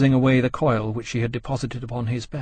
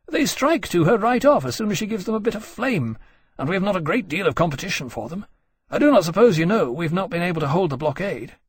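higher-pitched, brisk reporting officer; lightly voiced earnest officer; 6 semitones higher than usual. Officer briefs his friend on the blockade, earnest. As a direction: explain it eagerly, pitched up.

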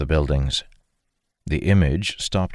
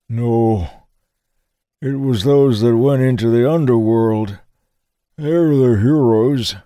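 put-on old man voice; 8 semitones higher, 6 dB louder than usual. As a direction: voice a frail old soldier lost in memory. Old man answers Dalen.

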